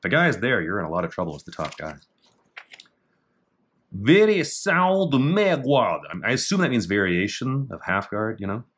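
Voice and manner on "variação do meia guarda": higher, slow, louder Portuguese title; Portuguese reading. Shown as a demonstration. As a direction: announce the Portuguese name slowly. Reading Portuguese technique name.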